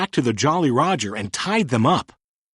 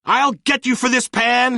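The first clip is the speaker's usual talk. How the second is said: yelling; 10 semitones higher than usual. A narrator's reading. Hook falling into the water, yelling after Peter. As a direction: shake a fist and yell it up at Peter. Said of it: "he yelled".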